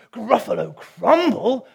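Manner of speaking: high, loud, slow monster cry of alarm; a big beast suddenly terrified; horrified Gruffalo. Acted. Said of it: "the Gruffalo said".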